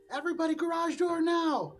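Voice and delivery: put-on high, loud kid shouting an order; kid yelling. Game master voices a kid to the players, yelling. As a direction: yell it out like a bossy child. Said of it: "You hear this kid kind of going".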